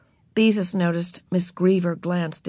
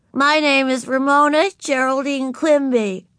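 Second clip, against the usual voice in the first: put-on little girl voice; 8 semitones higher, 4 dB louder than usual. A narrator's reading. Narrator voicing Ramona to Beezus, high and childlike. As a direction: play four-year-old Ramona, proud.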